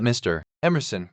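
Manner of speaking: usual speaking voice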